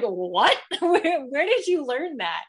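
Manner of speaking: loud laughing disbelief; amazed laugh. Retold or imitated. Parents laughing at their toddler's strange saying, amazed.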